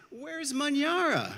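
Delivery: high-pitched storybook cry of alarm; alarmed calling. A narrator's reading. Villagers discover the daughter is missing, alarmed calling. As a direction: call out, stretched and high, for someone missing. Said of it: "But then a commotion arises".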